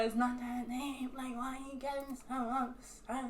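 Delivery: softly downplaying, mimicked; dismissive boyfriend. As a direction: imitate a boyfriend quietly brushing it off. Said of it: "he's coming in like".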